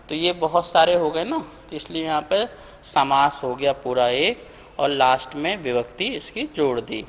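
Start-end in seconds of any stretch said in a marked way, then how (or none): none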